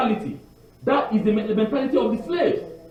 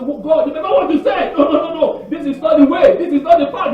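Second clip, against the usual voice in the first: higher, urgent pleading with God; 7 semitones higher than usual. Retold or imitated. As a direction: beg God to change his mind. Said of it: "begins to beg".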